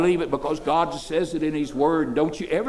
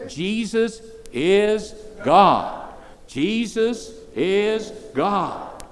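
slow, drawn out, higher; slow call and response; 5 semitones higher, much slower than usual. Shown as a demonstration. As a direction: lead the crowd, spacing every word. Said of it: "Help me".

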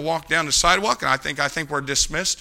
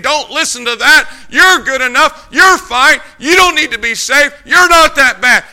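loud, high-pitched tempter's retorts; preacher voicing an insistent devil; devil's insistent urging; 8 semitones higher, 10 dB louder than usual. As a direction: push each false assurance hard. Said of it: "the devil's sitting over here saying".

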